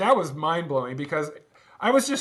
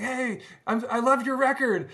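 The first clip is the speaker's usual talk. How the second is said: gushing, higher and faster like a starstruck fan; excited fan; 8 semitones higher than usual. Retold or imitated. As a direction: imitate an eager fan bubbling over. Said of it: "he jumps up all excited".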